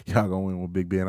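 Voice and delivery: usual speaking voice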